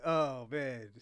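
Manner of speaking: normal talking voice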